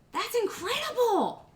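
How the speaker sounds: high, drawn-out disbelief; amazed exclamation. Real, not acted. Streamer reacting to a viewer's wild story, amazed.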